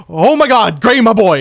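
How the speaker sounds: loud, high, proud cry; overjoyed exclamation. Retold or imitated. Friend finds Gray won the rooftop fight, loudly overjoyed. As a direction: burst out like a thrilled proud friend. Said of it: "the other side of the way [name] just said that".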